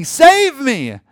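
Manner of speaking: high, loud cry for help; urgent shout from a burning building; screaming for rescue. Acted. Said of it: "they're screaming".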